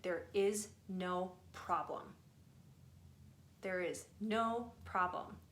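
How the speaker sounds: slow, deliberate, word by word; slow right down to drive it home; slow and emphatic. Real, not acted. Repeats the key message for emphasis.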